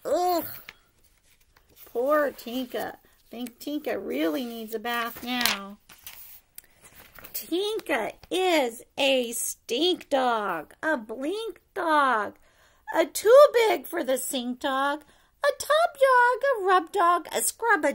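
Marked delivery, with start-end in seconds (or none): none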